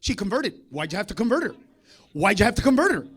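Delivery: usual speaking voice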